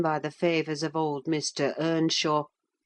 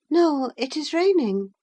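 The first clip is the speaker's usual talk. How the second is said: Catherine's voice; 10 semitones higher, 4 dB louder than usual. A narrator's reading. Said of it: "she answered".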